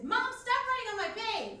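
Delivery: high, loud whine-yell at mom; exasperated yell. Acted. Grown child writing back to mom online, exasperated yell. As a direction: yell it like a fed-up kid. Typed retort to mom, acted out.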